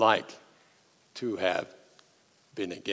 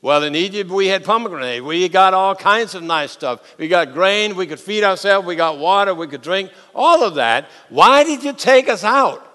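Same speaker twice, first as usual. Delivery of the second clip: grumbling complaint; 6 semitones higher, 5 dB louder than usual. Acted. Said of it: "they go and they say".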